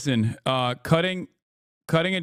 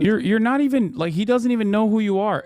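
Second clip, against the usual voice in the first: exasperated rant; 7 semitones higher than usual. Real, not acted. Streamer rants at Trump fans, exasperated and higher. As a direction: throw up your hands at them.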